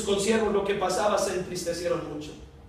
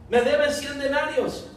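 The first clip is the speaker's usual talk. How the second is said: higher, slow, hard demand for the money; angry demand; 6 semitones higher, slower than usual. Acted. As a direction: squeeze the debt out of him. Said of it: "le ahogaba, diciendo".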